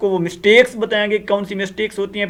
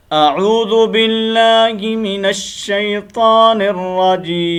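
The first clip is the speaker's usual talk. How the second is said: slow, drawn-out melodic tilawat; Quranic recitation; much slower than usual. Shown as a demonstration. Teacher opening the lesson, reciting in slow melodic tilawat. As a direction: recite it slowly with a rising chant.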